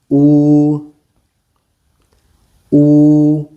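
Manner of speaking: "Oo" with lips rounded, like the oo in food; Mandarin u vowel. Shown as a demonstration.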